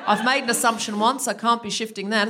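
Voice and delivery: insistent put-on Australian, amused; hammed-up Australian caricature; mock Australian. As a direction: play a stubborn Australian who won't budge. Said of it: "not saying all Australians are like this".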